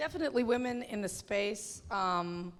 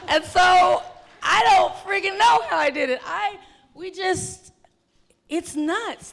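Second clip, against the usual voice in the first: higher and louder amazed shout; excited; 8 semitones higher, 8 dB louder than usual. Real, not acted. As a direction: marvel loudly at your own feat. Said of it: "amazed at herself now".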